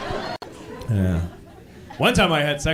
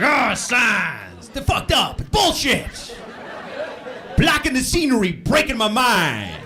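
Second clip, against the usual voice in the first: higher, hammed-up protest rant; mock mad singer; 5 semitones higher, slower than usual. Comic imitating a protest singer mad at signs. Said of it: "he gets all mad at signs".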